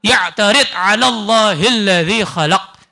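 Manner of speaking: slow, measured Arabic recitation; a slow devotional recitation of a classical Arabic verse; recited Arabic quote. Acted. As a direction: intone the scholar's words slowly. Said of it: "dikatakan Al-Habib Abdullah Al-Haddad".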